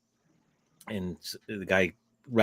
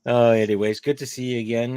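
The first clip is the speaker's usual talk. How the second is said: ordinary talk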